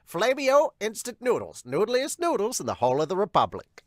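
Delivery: high-pitched mock sponsor plug; half-assed announcer impersonation. Host quoting a noodle ad, mock announcer voice. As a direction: read the plug like a hammy announcer. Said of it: "that was the voice".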